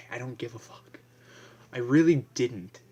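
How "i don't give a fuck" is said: breathy whisper; whispered shrug at the funeral. Real, not acted. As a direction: drop to a whisper. Whispered aside.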